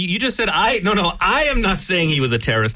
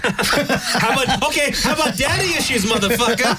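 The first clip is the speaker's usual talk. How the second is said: high-pitched, laughing retort; laughing comeback; 6 semitones higher than usual. Real, not acted. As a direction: toss it back through laughter.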